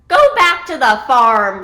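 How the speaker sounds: loud, scornful storybook yell; big dismissive shout; yelled back. A narrator's reading. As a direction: yell it back to send her off. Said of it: "pigeons yell right back at her".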